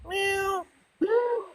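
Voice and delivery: very high squeaky meowing; calling a cat. Real, not acted. Calling a cat in with very high meows.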